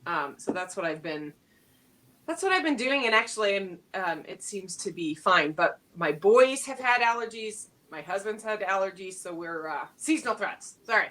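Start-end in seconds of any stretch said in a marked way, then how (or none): none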